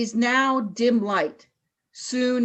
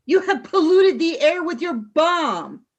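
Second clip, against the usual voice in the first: louder, higher, indignant; angry accusation; 6 semitones higher, 4 dB louder than usual. Acted. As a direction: throw the accusation at them. Leader accuses the Chumblies.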